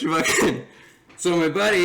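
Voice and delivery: ordinary talk, nothing marked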